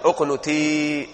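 teacher stressing the correct اقنتي slowly; drawn-out demo. Shown as a demonstration. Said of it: "أنا أخاطب أنثى".

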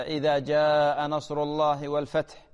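slow chanted recitation of إذا with no added و; correct recitation. Shown as a demonstration. Sheikh models the right reading for Um Fawaz, slow and chanted. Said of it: "يعني الآن مثلًا".

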